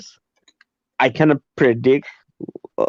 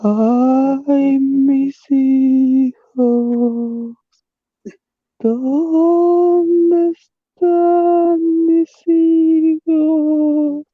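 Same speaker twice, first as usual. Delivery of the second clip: very high, slow ghostly wail; weeping woman; 14 semitones higher, much slower than usual. Acted. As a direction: wail slowly like a grieving ghost. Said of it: "That, that's a weeping woman".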